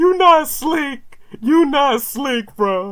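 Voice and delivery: squeaky high-pitched teasing. Real, not acted. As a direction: tease him in a squeaky high voice. Teasing the copied line.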